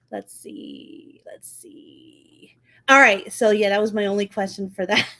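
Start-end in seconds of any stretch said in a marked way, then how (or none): 0.1-2.6 barely audible whisper; absent, whispered muttering; whispered to self. Real, not acted. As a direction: think aloud under your breath. Murmuring to self while checking notes.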